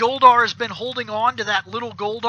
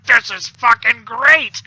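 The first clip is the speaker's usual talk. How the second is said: loud, slow hammed-up delight; Goldar amazed; 9 dB louder, slower than usual. Goldar tastes the taffy, loud mock-monster delight. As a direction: boom it out in a put-on monster voice.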